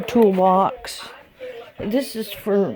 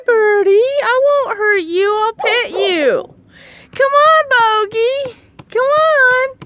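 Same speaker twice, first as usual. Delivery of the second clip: much higher, gentle coaxing; high coaxing pet talk; 5 dB louder than usual. Acted. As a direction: coax a shy pet to come closer. Coaxing shy bird.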